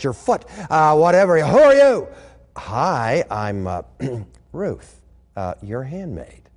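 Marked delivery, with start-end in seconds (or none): 1.4-2.1 loud, high startled cry; jolted awake and yelling out; shocked. Acted. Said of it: "he's shocked". 2.6-6.5 soft, low, bashful reply; timid girl almost under her breath; shy. Acted. Ruth shyly introduces herself.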